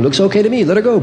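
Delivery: high-pitched cartoon brain voice; squeaky-high all-clear from the brain; brain giving approval. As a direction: pipe the verdict up high. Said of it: "And the brain goes".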